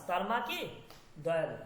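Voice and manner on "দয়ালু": ordinary talk, nothing marked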